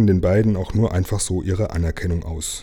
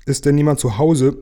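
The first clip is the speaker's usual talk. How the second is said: high-pitched questioning call; 7 semitones higher, 4 dB louder than usual. A narrator's reading. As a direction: call into the dark for anyone home. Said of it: "rief plötzlich eine Stimme".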